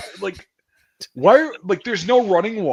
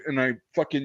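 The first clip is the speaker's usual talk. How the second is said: usual speaking voice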